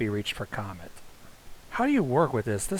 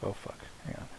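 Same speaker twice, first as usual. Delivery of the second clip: usual speaking voice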